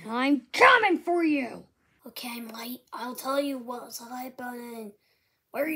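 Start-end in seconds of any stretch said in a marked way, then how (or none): 2.1-4.9 whispering. Acted. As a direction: whisper it like a secret. Secret message whispered.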